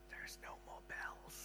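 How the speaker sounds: whispered aside, no voice at all; hushed whisper. Retold or imitated. People behind the curtain notice the bells stop, whispering. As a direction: breathe it like a shocked onlooker.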